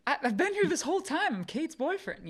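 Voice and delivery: high, loud acted-out protest; indignant protest. Acts out boyfriend's startled protest.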